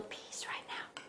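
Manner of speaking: soft conspiratorial whisper; whispered aside. Real, not acted. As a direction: whisper like sneaking a treat. Sneaking an early taste.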